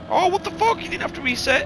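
high, loud squeal of disbelief; shocked. Real, not acted. Gamer reacting to a replay, stunned disbelief. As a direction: jump way up in pitch, stunned.

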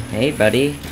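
ordinary talk

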